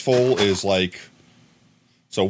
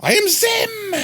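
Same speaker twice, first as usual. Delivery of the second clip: shrieking cartoon alien, far higher; Zim voice attempt; slower than usual. Viewer's name turns green, so shouting as Zim. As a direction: yell it as a tiny invader boss. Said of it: "I can't do the voice".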